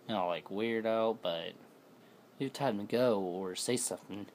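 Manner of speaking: ordinary delivery, nothing marked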